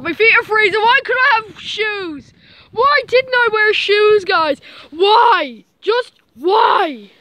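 loud, high, exasperated shouting; losing temper. Acted. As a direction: rant about freezing feet. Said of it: "I've lost my temper".

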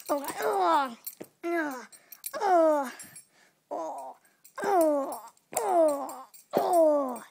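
loud hammy moans of pain; pained groans. Pretend. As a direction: groan loudly with each hit. Pet being beaten.

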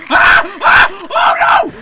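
real startled high screams; piercing jump-scare shrieks; screaming. Real, not acted. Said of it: "Sorry for screaming".

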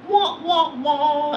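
high, whiny mock sad trombone; sing-song letdown sound effect; comic deflated wah wah wah.

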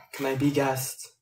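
ordinary talk, nothing marked

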